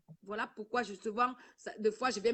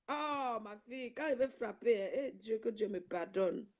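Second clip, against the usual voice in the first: normal talking voice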